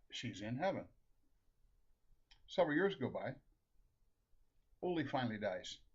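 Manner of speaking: normal talking voice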